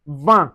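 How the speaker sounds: "vin" as a nasal va, no N sounded. Shown as a demonstration. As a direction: say "vin" with one nasal vowel. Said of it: "you pronounce it as un".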